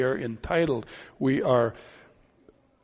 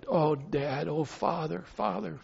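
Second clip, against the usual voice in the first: softer, tender cry to Dad; tender soft call; 7 dB quieter than usual. Pretend. Child tenderly calling his father, soft and intimate. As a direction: whisper it like a son to his father.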